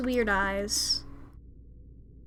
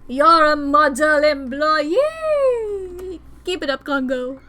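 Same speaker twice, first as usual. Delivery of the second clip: mock loud praise; 5 semitones higher, 11 dB louder than usual. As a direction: boom it out like fake applause. Mocking praise to coworker.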